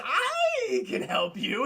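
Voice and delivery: very high, drawn-out creature shriek; Smeagol-like creature. Retold or imitated. Creature in the dark offers Aladdin help, screechy voice. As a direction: stretch it into a loud, eerie squeal. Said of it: "this fucking Schmeagle creature".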